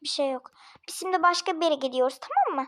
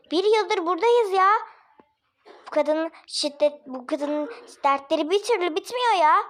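normal talking voice

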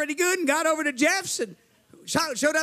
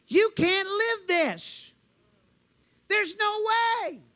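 devil's discouragement; 7 semitones higher, much slower than usual. Retold or imitated. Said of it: "The devil says".

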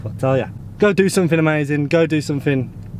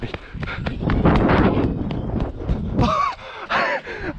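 laugh. Real, not acted. After a scary drop.

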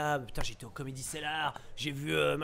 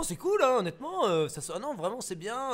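mock gushing; 7 semitones higher than usual. Actor gushing to a foreign journalist, higher and eager. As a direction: gush like a nervous actor at a premiere. Acting a gushing interview answer.

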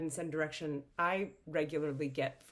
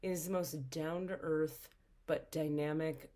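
normal talking voice

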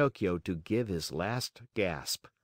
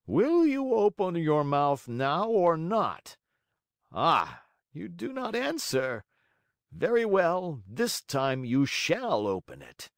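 assassin voice; 6 semitones higher than usual. Acted. Assassins threaten Pinocchio.

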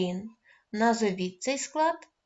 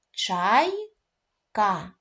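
syllable by syllable; 3 dB louder than usual. Shown as a demonstration. Teacher counting syllables for pupils, "чайка" split slowly. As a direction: split into slow, separate syllables "чай - ка". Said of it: "Називаємо слово".

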